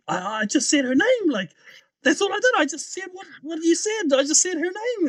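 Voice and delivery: high-pitched, protesting self-defence; arguing defensively. Retold or imitated. Brother arguing with the nurse, defensive. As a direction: plead innocence, voice right up. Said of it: "are having this argument".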